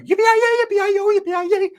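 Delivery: very high, far-off yodelling cheer; mom's yippee cheer. Retold or imitated. Mom back from her go-kart ride yells yippee, very high. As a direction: whoop it up high like a cowgirl. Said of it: "she came back like".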